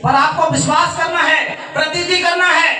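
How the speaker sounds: preaching at full force. Acted. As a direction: press the flock to believe, voice climbing. Preacher exhorts congregation.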